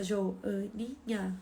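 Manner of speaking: soft, slow, pondering each word; child thinking it over. Retold or imitated. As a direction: weigh the two words quietly like a child. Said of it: "E aí ele pensa". (criança pensando baixinho e devagar)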